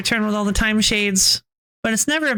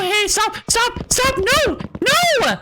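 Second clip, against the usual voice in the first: high, panicked stop stop; urgent, rising alarm; alarmed shouting; 12 semitones higher, much slower than usual. Real, not acted. Streamer panics skipping dialogue.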